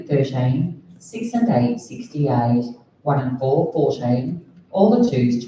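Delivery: sing-song number calling; bingo caller. Acted. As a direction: call the numbers like a bingo host.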